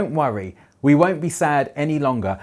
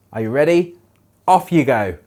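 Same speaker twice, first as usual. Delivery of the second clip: ordinary talk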